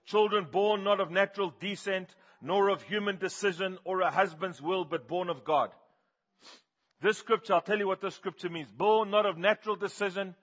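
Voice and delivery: loud, high, driving sermon voice; full force preaching. Acted. Preacher telling the church about Gary, at full force.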